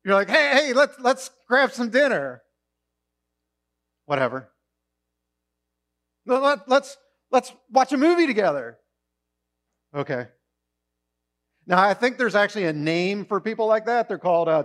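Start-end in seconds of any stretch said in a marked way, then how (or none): 0.3-2.4 higher, louder keen ask; eager pleading. Pretend. Friend eagerly suggesting dinner, pleading. 4.1-4.5 low, dismissive whatever; bored. Pretend. Mimicking a bored teenager's reply, dismissive. 6.2-8.8 higher, louder keen ask; eager pleading. Pretend. Friend eagerly suggesting a movie, pleading. 9.9-10.2 low, limp okay; barely bother; bored. Pretend. Mimicking a bored reply, unenthusiastic.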